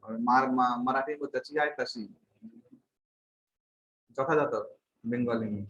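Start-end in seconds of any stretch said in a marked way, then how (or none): none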